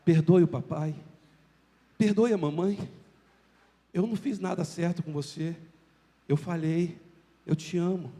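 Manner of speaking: put-on soft, gentle parent kneeling to a child; quiet, sincere father asking forgiveness; tender apology. Shown as a demonstration. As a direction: speak softly, eye to eye with your child. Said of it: "olhar nos olhos da criança e falar".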